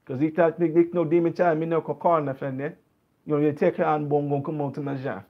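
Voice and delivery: thick Jamaican patois; real Jamaican accent. Shown as a demonstration. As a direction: slip fully into native Jamaican patois. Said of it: "That's the real accent right there".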